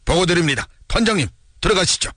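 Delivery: high, formal soldier's report; military report. A narrator's reading. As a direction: report smartly to your commander. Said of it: "힘차게 탁 굴리며 말했다".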